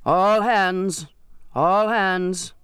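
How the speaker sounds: calls across the deck to the crew; mate bellowing all hands; hailing the whole crew from the rail. A narrator's reading. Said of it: "at the rail bellowed".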